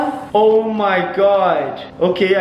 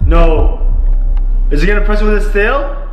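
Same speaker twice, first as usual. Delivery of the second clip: alarmed outburst; 3 semitones lower, 7 dB louder than usual. Real, not acted. Snake climbing onto the treadmill.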